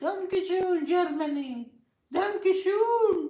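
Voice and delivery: hammed-up sarcastic Danke schön; mock thanks. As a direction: sneer a fake polite thanks. Mocking German thank-you.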